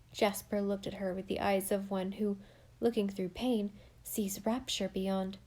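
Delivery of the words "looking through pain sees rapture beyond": usual speaking voice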